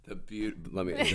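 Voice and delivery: ordinary talk, nothing marked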